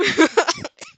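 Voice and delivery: loud high burst of laughter. Real, not acted. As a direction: cackle at the memory. Narrator laughs.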